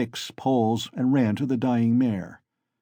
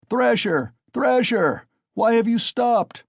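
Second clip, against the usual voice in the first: very high, loud jeering calls; crowd shouting; 12 semitones higher, 5 dB louder, slower than usual. A narrator's reading. Crowd egging Mikolka on to keep beating, high loud jeers. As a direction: goad him from the crowd. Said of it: "shouted voices in the crowd".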